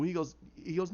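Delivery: ordinary delivery, nothing marked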